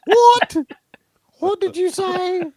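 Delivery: high, loud, indignant outburst; offended actress. Acted. Actress reacting to being called little nose, high mock outrage. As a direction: play her shrieking in mock outrage. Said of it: "And she'd be like".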